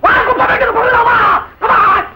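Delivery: high, goading shout; shouted challenge. Acted. Man daring his rival to come outside, shouted challenge. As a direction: egg him on furiously.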